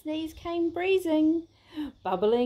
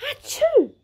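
loud sneeze; 13 semitones higher, 9 dB louder than usual. A narrator's reading. Big sneeze in story.

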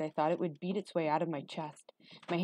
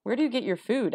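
put-on high, louder boy's question; insistent, pitched up; probing question; 6 semitones higher, 5 dB louder than usual. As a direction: press him with a raised voice. Boy presses Igor with questions.

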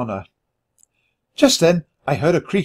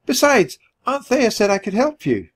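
put-on high, childlike voice; squeaky, eager argument; pleading little nephew; 9 semitones higher than usual. A narrator's reading. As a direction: pipe up like a small mouse. Small nephew argues to come along.

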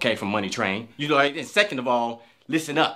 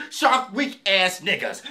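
ordinary talk

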